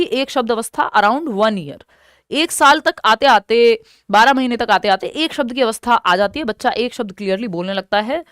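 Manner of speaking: ordinary talk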